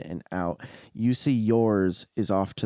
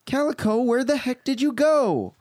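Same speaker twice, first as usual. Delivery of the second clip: put-on very high little girl voice; squeaky sassy toddler calling out; young Princess Leia; 4 dB louder than usual. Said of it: "sounds like young Princess Leia".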